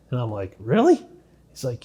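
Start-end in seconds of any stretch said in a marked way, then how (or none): 0.6-1.1 high, astonished "Really?"; surprised. Retold or imitated. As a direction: pitch jumps up in disbelief. News of Free Clark campaign.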